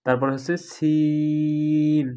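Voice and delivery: সিন held long and slow; drawn-out Seen. Shown as a demonstration. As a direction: stretch সিন out. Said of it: "এখানে কিন্তু সিনের".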